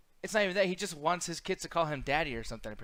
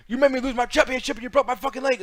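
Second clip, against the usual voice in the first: higher, louder wrestler rant; a hammed-up angry promo line; mock angry promo; 9 semitones higher, 9 dB louder than usual. Imagining a wrestler's reasonable complaint as a loud mock rant. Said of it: "I can see if he was saying".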